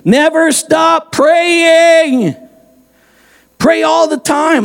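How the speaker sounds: high, very slow stretched verse; emphatic scripture reading. Acted.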